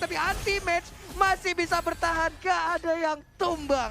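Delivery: loud excited play-by-play; esports caster. Real, not acted. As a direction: shout the team fight call. Caster hype over team fight.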